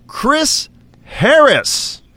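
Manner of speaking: high, slow, drawn-out winner reveal; grand announcement. Acted. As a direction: announce it like a game show host.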